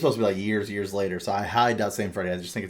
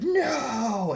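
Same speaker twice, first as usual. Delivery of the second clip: mock scream. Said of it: "he starts screaming at you".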